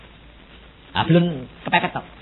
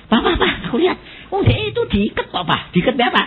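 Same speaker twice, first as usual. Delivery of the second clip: son's alarmed voice; 10 semitones higher than usual. Retold or imitated. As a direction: call out to Papa in alarm. Voicing his son Denny's alarm. (suara anak kaget, tinggi dan keras)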